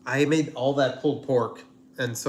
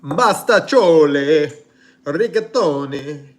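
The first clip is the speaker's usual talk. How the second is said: slow, loud, higher hammed-up Italian accent; mock Italian accent; 4 semitones higher, 12 dB louder, much slower than usual. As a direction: belt out the pasta names like a cartoon Italian chef, slow and loud. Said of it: "rate my accent".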